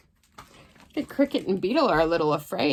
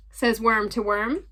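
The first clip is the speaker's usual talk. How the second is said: plain reading voice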